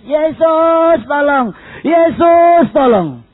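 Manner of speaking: high drawn-out call to Jesus; insistent shout for help; keeps crying out. Retold or imitated. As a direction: stretch the name in a loud plea. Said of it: "dia teriak terus".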